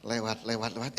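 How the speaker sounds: hushed, low repeated command; will them past under your voice; inward spell. Retold or imitated. Preacher retells willing the collector past, low and soft. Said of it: "saya batin itu tukang kolektenya".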